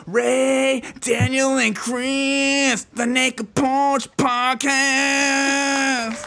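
high, drawn-out ring announcer call; big sustained show introduction; announcer intro. Pretend. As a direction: stretch the names out like a hype man. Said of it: "that was awful".

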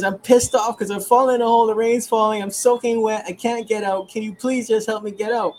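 high, exasperated mock rant; loud at first, imitating someone at the end of their rope; pissed-off outburst. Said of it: "he starts to get pissed off".

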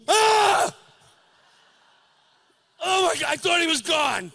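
loud, high startled shriek. Retold or imitated. Son startled by his father's breath returning, loud high shriek. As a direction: jump with fright at the sudden breath. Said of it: "I'm like".

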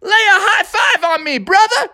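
Froggy hyped, shouting to his receiver; Froggy cheering. Acted. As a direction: celebrate the touchdown. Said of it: "And Froggy said".

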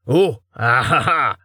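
slow hammed-up laugh; acted laugh. Carten lobs the goat through, laughing. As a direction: laugh it out in character.

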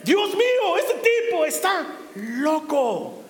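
awed; amazed. Real, not acted. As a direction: marvel at this crazy man. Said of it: "marvel at Joshua".

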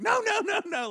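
high, loud pleading no; alarmed no. Retold or imitated. Friends at the bar begging Frank not to fight, loud and high. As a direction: stop him before he goes.

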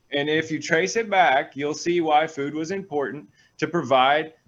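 usual speaking voice

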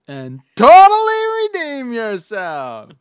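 loud, high, slow mock exclamation; mock exclaim. Quoting Harry to Lloyd, high loud mock exclaim. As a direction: blurt it high and loud like the movie.

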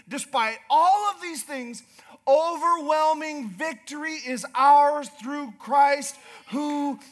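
shouting triumph over the congregation; full-force preaching. Acted. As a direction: drive it home from the pulpit. Preaching builds to climax.

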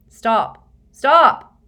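loud, rising shouts; shouting. A narrator's reading. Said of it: "Harry shouted".

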